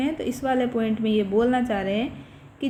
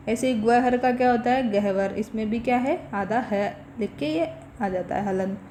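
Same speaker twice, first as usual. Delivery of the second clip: normal talking voice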